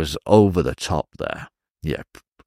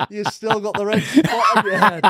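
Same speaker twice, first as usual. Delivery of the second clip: laughing retelling; 13 semitones higher than usual. Retold or imitated. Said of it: "A friend whispered in my ear".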